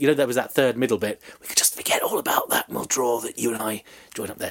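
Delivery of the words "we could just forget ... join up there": whispered scheming aside; hushed plotting voice; conspiratorial whisper. Retold or imitated. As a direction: whisper it like a secret deal. Kings conspiring in retold history.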